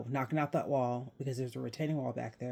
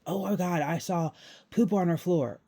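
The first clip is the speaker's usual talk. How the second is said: higher, louder scandalized quote; mock shocked; 4 semitones higher, 5 dB louder than usual. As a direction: imitate an aghast gossip reporting a find. Quoting a shocked community commenter.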